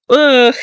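loud frustrated ugh and a burst of laughter; real annoyed groan into a laugh; groan then laugh. Real, not acted. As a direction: groan at the flub, then crack up.

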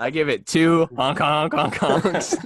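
silly clown. Pretend. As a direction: clown it up with honks. Clowning goofy honk rating.

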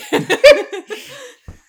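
loud, high burst of laughter; real laughter. Real, not acted. Recalling classmates who forgot lines, a loud high burst of laughter. Laugh after a story's punchline.

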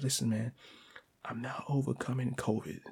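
hushed, discreet confession; under his breath. Retold or imitated. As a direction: say it very softly so bystanders won't hear. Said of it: "I said it under my breath".

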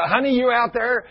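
high, loud call across the yard; mock wife calling husband. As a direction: call to someone outside. Wife calling from back door.

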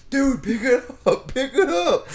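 high, loud, slow mock yell; retold exasperated yell. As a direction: urge the driver as the alarmed coworker. Coworker yells about dragged machine.

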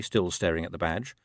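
ordinary delivery, nothing marked

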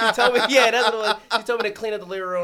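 loud, high, still laughing; laughing fit while correcting the story. Real, not acted. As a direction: blurt it out fast through laughter.

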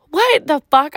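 stunned outburst at the stranger's message; shocked. Retold or imitated. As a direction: blurt it out in shock.

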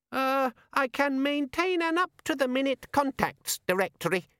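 very high, slow, monotone imp; high-pitched sales patter from a nervous demon; uneasy gadget demon. A narrator's reading. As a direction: pipe it out like a hesitant little machine. Uneasy demon keeps offering features.

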